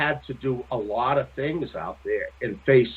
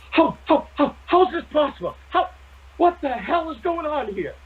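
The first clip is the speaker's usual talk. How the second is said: freaked out; 12 semitones higher than usual. Retold or imitated. Julianne voiced freaking out.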